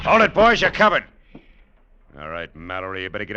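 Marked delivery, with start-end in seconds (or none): 0.1-1.0 sharp loud warning, high; commanding shout. Acted. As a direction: bark the order at the gunmen. Gun drawn on the gunmen.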